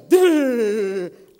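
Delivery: loud, drawn-out thunder rumble; imitated thunder. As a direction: boom out a long thunder noise. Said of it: "And then thunder".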